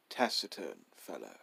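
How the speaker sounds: plain reading voice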